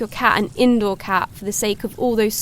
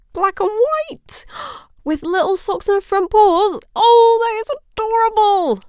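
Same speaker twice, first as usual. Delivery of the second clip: high, delighted squeal building louder; gushing; 10 semitones higher, 5 dB louder than usual. Acted. Streamer hears the new kitten's colouring, gushing. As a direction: coo high over something cute. Gushes over kitten's description.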